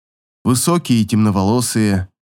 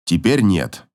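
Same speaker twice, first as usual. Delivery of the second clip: curt; 5 semitones lower, slower than usual. A narrator's reading. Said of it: "отрезает он".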